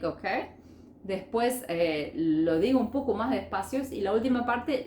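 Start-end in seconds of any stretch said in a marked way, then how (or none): none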